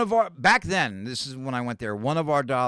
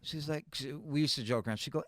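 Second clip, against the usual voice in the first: ordinary talk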